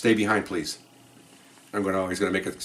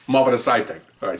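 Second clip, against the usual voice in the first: usual speaking voice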